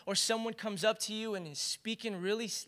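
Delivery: usual speaking voice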